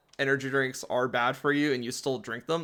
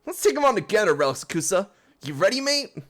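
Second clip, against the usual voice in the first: put-on high, loud rallying British accent; rally your partner in a cheerful British accent; 6 semitones higher, 5 dB louder, faster than usual. Hop rallying the player, high British accent. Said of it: "It's English, but with an accent".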